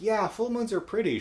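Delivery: lightly voiced little girl, dreamy. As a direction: chirp it like a naive kid. Terry, a scared child.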